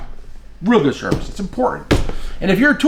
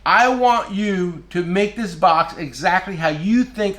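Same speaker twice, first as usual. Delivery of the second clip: slow quote; 3 semitones higher, much slower than usual. Retold or imitated. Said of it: "said to me".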